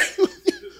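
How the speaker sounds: high squeal of a laugh. Real, not acted. Preacher squeals with laughter over the free shoes.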